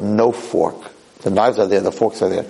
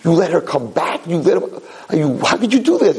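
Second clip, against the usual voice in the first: hammed-up high outcry of disbelief; mock screaming; 7 semitones higher than usual. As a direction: act out appalled men screaming at the guys. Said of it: "are screaming at the guys".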